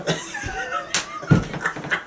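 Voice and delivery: very high, soft squealing laugh; laughter. Real, not acted. Host cracking up over the island mix-up, squealing laughter. Cracks up after being corrected.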